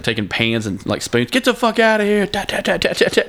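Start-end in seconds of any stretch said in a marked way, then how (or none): 1.3-2.2 shrill mock yell, much higher; high yelling at a bear. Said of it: "they're just, like". 2.2-3.2 pan banging noise. Retold or imitated. Banging pans imitated.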